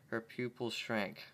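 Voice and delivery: usual speaking voice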